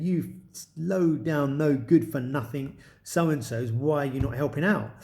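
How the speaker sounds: ordinary talk, nothing marked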